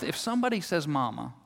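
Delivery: soft muttered threat; mumbling under breath. Retold or imitated. Worn-out mom mutters under her breath, soft. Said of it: "mumbling under her breath".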